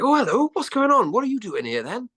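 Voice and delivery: high, lilting Welsh greeting; Welsh accent. Retold or imitated. Curious local greets extras in pub, Welsh accent. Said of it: "that's a Welsh accent, by the way".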